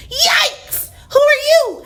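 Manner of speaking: high, surprised squeak; startled. A narrator's reading. Mabel startled by a detective, high and surprised. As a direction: jump at the stranger. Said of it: "asked Mabel".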